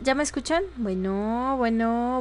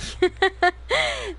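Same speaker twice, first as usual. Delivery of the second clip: laughing; 6 semitones higher than usual. Real, not acted. Mic not working.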